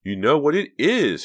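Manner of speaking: almost shrieking it; cry out. A narrator's reading. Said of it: "she cried".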